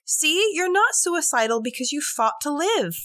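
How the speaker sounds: high, loud gleeful voice, imitating him; hammed-up proud and pleased; mock cheerful killer. Retelling killer's gleeful justification.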